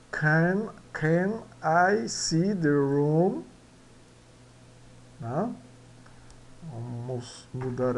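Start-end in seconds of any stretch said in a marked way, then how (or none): 0.1-3.4 "can I see the room" drawn out slowly; slow repeat. Shown as a demonstration. (repetição lenta da pergunta)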